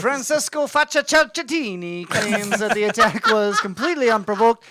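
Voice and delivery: hammed-up Italian accent that drifts Irish; bad Italian accent. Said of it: "Can you just in an Italian accent".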